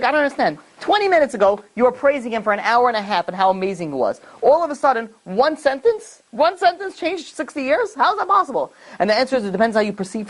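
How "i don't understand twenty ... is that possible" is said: high, outraged disbelief, slowing on "one sentence"; a wronged widow's stunned, rising protest; retold screaming. Widow protesting to the old man, in pitched-up outrage. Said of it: "he started screaming".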